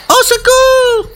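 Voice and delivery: high, drawn-out cry for help; cri de détresse. Acted. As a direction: call out for help as a frightened young girl, very high. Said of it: "criait une jeune fille". (cri aigu de jeune fille appelant au secours)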